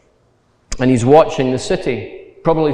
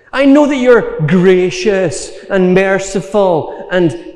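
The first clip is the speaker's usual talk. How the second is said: higher, louder, exasperated complaint; furious Jonah; 6 semitones higher, 3 dB louder than usual. Retold or imitated. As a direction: hurl the accusation back at God. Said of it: "Jonah is furious".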